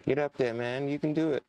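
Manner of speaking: root for him out loud; cheering on. Real, not acted. Cheering on his character.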